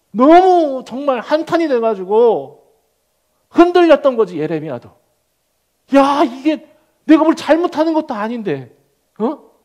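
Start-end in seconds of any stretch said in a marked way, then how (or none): 6.0-9.5 higher, louder bewildered outcry; a prophet's shaken complaint; anguished protest. Acted. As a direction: cry out at the unfairness. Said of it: "막 흔들리는 거야".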